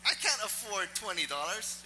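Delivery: comic exasperated outburst; indignant inner protest. Acted. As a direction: protest loudly that you can't possibly pay. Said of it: "I was thinking that in my head".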